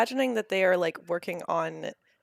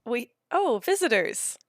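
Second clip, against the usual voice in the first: surprised greeting; 4 semitones higher, 4 dB louder than usual. Acted. Goat character greets visitors.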